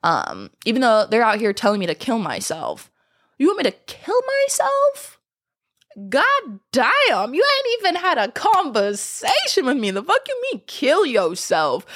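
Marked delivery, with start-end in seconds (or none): 3.4-11.8 high incredulous squeal; high-pitched shocked outrage; outraged disbelief. Real, not acted. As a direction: shriek it in shock. Outraged at commenters.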